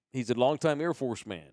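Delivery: usual speaking voice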